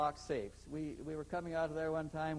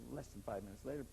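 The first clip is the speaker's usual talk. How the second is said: ordinary talk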